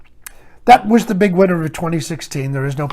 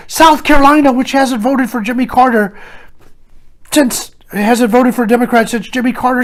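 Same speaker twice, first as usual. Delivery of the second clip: high-pitched excited newscaster impression; Rachel Maddow; 5 semitones higher, 4 dB louder than usual. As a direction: imitate a thrilled election anchor, starting loud. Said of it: "She was so excited".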